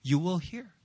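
soft, near-whispered assurance; hushed emphasis. Shown as a demonstration. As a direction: drop the voice so they lean in. Demonstrates the quiet thing.

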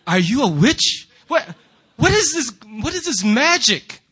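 loud, high-rising bewilderment; astonished disbelief. Acted. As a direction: marvel at it as if at witchcraft. Amazed at teacher's trick.

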